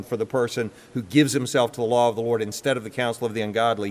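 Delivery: usual speaking voice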